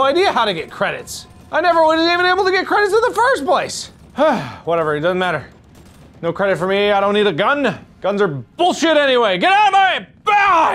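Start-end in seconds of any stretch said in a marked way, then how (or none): 1.5-3.9 exasperated, very high complaint; high-pitched whine. Real, not acted. Player who cannot get credits, high-pitched whine. 9.4-10.7 high yell. Pretend. Player charging through obstacles, high yell.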